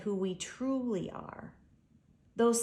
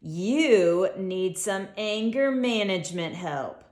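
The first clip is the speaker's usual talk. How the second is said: a louder, mocking giraffe sneer; jeering; 7 dB louder than usual. A narrator's reading. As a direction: talk down to him with a sneer. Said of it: "briefly lowers his gaze and jeers".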